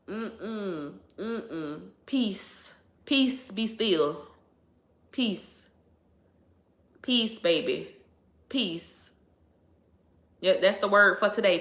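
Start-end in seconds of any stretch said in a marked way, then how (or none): 0.1-9.1 soft murmured mantra. Real, not acted. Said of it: "soft repeated peace mantra".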